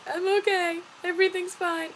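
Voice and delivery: high, whiny fake-brave voice; mock tearful reassurance. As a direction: insist on being fine in a pretend weepy squeak. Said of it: "I was crying".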